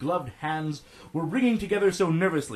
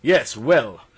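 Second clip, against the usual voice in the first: louder, with a bit of a huff; slightly huffy; 4 semitones lower, 6 dB louder, slower than usual. A narrator's reading. Doctor replying to a novice, a bit huffy. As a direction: huff it out, mildly put out. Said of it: "with a bit of a huff".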